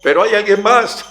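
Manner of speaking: high, loud cheeky plea; high desperate plea. Retold or imitated. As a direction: shout up, still hoping for someone else. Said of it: "Y el catalán dijo". (súplica aguda y fuerte)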